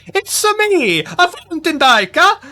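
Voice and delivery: loud, high cartoon Italian accent. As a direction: burst into a hammed-up Italian caricature. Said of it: "ready to do an Italian accent".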